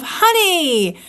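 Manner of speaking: a high, loud call of honey; loud cry. A narrator's reading. Pooh spotting the bottle, calling out loudly. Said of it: "with one loud cry of".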